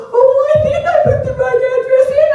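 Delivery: high, fast, loud comeback; mock husband in a quarrel; flustered excuse.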